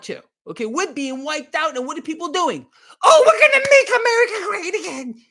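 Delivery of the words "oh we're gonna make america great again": high, loud, laughing mock-cheer of the slogan. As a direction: parody the naive crowd with a shrill laughing shout. Mocking people's slogan.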